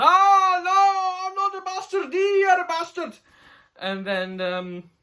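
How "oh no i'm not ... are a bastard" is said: much higher squeaky voice; hammed-up dwarf insult; mock retort. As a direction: fling the insult back in falsetto.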